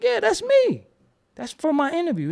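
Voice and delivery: very high, loud exclamation; retold excitement, voice shooting up; excited confirmation. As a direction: claim it with a high-pitched burst. Retells confirming it is him again.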